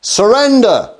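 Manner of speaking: a general's high, loud bellow; shouted command. Retold or imitated. Alexander shouting up to the king on the wall, loud. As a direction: roar it up at the city walls. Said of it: "And he shouts up".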